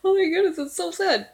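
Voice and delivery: high, loud mock dismay; imitating his dismay. Reactor quotes commentator's sad reaction, imitated dismay. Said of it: "because he's like".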